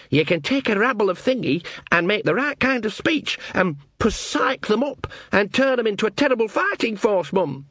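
a keen son selling his plan to Mum; eager young Sean. Acted. Sean keen on rousing the troops.

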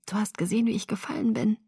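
very soft, half-whispered; timid question. A narrator's reading. Bella swallowing, asking Alice what she saw, timid hush. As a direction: ask it small and hushed.

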